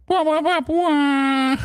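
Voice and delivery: sung-out wah wah wah; air horn imitation. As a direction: blare it like a sad air horn. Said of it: "this is where I'd hit the air horn".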